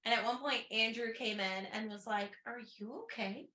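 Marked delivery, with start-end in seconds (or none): 2.5-3.5 lightly voiced husband, much softer; a soft, concerned check-in from the husband; husband gently asking if she's okay; quiet husband voice. Voicing husband Andrew's concern.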